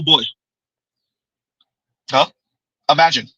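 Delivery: usual speaking voice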